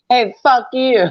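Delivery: high, loud cheeky taunt; mock taunting shout. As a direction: kids taunting a deaf relative, loud and high. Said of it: "me and my cousin would be like".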